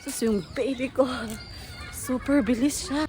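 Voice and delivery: high, loud, delighted praise; excited cheer. Real, not acted. Mother cheering her baby running outside, loud and delighted.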